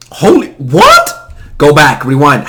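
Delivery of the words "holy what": high, slow, loud holy what; mock shocked viewer; stunned disbelief. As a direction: gasp it out in shock.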